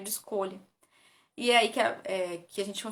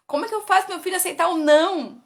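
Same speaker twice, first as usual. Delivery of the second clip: higher, louder exasperated parent; quoting a worried parent; 8 semitones higher, 9 dB louder than usual. Retold or imitated. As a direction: plead like a frustrated parent. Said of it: "me perguntam assim". (pai aflito, voz mais alta e forte)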